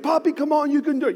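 a small cheer from the roadside; cheering kids. Retold or imitated. Kids cheering their dad on the run, high light cheer.

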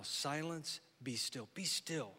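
quiet, slow "be still"; soft repeated calm. Shown as a demonstration. Pastor repeating Jesus' command to calm listeners, hushed. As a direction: let the words settle, hushed. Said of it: "that's a good word for us".

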